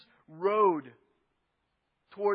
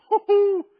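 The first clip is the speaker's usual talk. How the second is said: cheering whoop; 11 semitones higher, 9 dB louder than usual. Acted. Pilgrims cheering.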